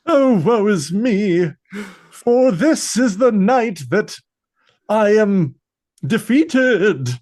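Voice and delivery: mock-tragic theatrical wail, drawn out; overly dramatic accent. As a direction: ham it up like a doomed stage hero, slowly. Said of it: "a overly dramatic, like, accent".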